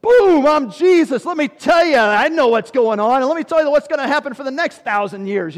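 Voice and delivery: loud, high, commanding proclamation; booming Jesus. Pretend. Imagined Jesus putting disciples in their place, loud and booming. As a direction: boom it out like an almighty ruler. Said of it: "do you expect him to just like".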